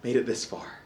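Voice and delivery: soft, hushed self-talk; whispered relief. Retold or imitated. Parent sneaking the arm out from under the sleeping baby, hushed relief. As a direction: keep it hushed so the baby stays asleep. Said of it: "And I'm like".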